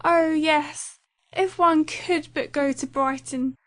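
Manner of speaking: put-on eager young girl. A narrator's reading. Lydia eagerly echoes her mother about Brighton. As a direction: seize on the idea eagerly.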